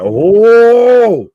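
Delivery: long, loud, high "oh!"; shocked shout. Real, not acted. Co-host reacting to a brutal wrestling stunt, shocked shout. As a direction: cry out at a big crash.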